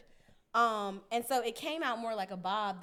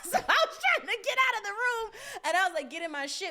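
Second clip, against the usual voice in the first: ordinary delivery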